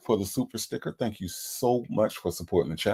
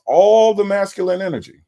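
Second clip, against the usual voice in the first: mock hype; 10 semitones higher, 8 dB louder than usual. Mocking caller's manly voice.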